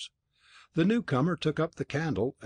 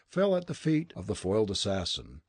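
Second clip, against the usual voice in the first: plain reading voice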